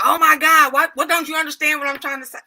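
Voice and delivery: high, loud exasperated outburst; exasperated people. Retold or imitated. Mocking upset people who lose patience. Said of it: "They be like".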